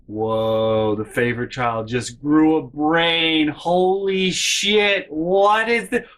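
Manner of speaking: slow, rising, hammed-up astonishment; mock amazement. Narrator mocking sister's sudden sense, amazed. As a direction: marvel at it sarcastically. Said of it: "I am sitting in my chair like".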